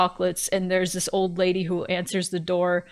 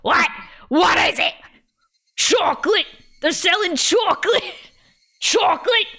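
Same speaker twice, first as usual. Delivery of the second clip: high, loud cartoon shrieking; hammed-up high old-lady holler; SpongeBob old lady; 8 semitones higher, 4 dB louder than usual. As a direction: imitate an ancient woman yelling, hard of hearing. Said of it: "the old lady from SpongeBob voice".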